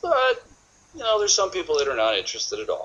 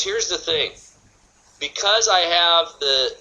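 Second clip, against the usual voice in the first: normal talking voice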